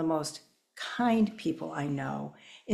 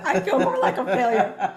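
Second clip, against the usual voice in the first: laughing; 5 semitones higher, 9 dB louder than usual. Real, not acted. Laughs at own failure.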